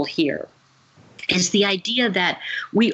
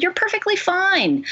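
upbeat reassurance; 11 semitones higher than usual. Retold or imitated. Retold reassurance to the husband.

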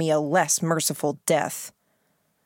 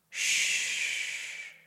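breathy whispered hush; whispered shush; 9 dB quieter than usual. A narrator's reading. Baron hushes the narrator.

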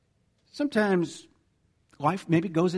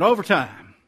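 Overtime said loudly and firmly; emphatic repeat; 6 dB louder than usual. Real, not acted. Pastor repeating the word a listener supplied, emphatic. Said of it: "Thank you, Preston".

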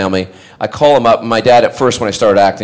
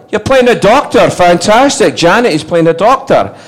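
high, loud mock-dad amazement; thrilled dad; 9 semitones higher, 4 dB louder than usual. Dad hearing his son plays a doctor, thrilled. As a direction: exclaim it high, then announce it to your wife.

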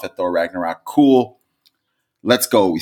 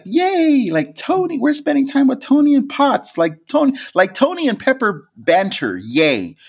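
squealing, much higher fangirl Yay; mock excitement. Boyfriend imitating his girlfriend's usual fan joy, much higher and gushing. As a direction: gush about Tony like a giddy fan. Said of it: "her first reaction is like".

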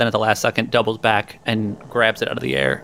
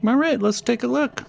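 high character voice; 12 semitones higher than usual. Acted.

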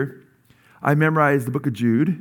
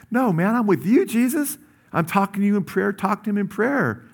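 higher-pitched, mock-earnest reply to Jesus; acted-out prayer; 7 semitones higher than usual. Driver talking to Jesus instead of getting upset in traffic, acted-out prayer. Acting out praying while stuck in traffic.

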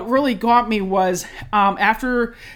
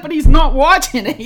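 louder, higher self-reassurance; mock reasoning; 6 semitones higher, 10 dB louder than usual. Correcting herself about partner's laughter, loud mock reasoning. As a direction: talk yourself down, a bit loud. And I'm like.

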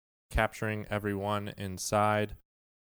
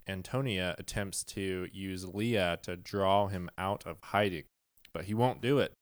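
usual speaking voice

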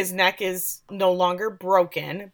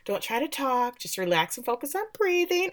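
mock-gentle priest, pitched higher; hint of a priest calming a hurt man; soothing priest; 7 semitones higher, 5 dB quieter than usual. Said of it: "Father's just saying".

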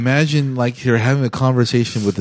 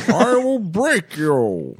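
barbarian; 7 semitones higher, much slower than usual. Acted. Menacing movie quote repeated.

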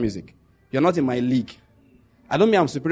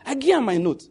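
Warri Pidgin line; 7 semitones higher than usual. Retold or imitated. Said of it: "The guy said".

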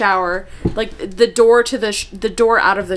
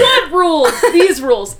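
laughing; 6 semitones higher, 3 dB louder than usual. Retold or imitated. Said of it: "retelling the rules scene".

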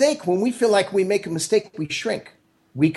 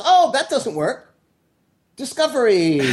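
delighted discovery; 8 semitones higher than usual. Acted. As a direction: act out a happy eureka. Acts out Edison welcoming failure.

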